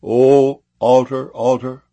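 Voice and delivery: loud, slow call to the altar; crying out. Acted. Prophet crying out to the altar, loud and slow. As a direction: proclaim it slowly and loudly. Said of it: "And he cried against the altar".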